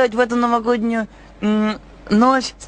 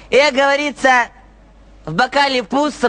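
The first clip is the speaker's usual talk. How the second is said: ordinary talk, nothing marked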